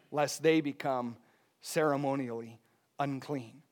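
normal talking voice

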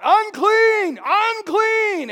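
loud, high warning shout; yelling unclean. Retold or imitated. Leper shouting a warning to passersby, loud and high. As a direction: cry out to keep people away. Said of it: "they would have to yell out".

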